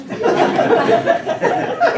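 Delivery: very high airy laugh; squealing laugh. Real, not acted. Laughing at the buttered popcorn.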